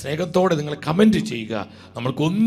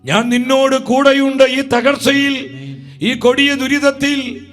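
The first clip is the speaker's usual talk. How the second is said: rising, ringing preacher's voice over music; fervent preaching; 8 semitones higher, 4 dB louder than usual. Acted. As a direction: preach it to the congregation with conviction. (ആവേശത്തോടെയുള്ള പ്രസംഗം)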